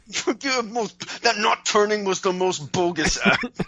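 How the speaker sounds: wrestler talking through shut teeth; clenched teeth. Acted. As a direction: squeeze it out with jaw locked. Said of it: "given her the clenched teeth".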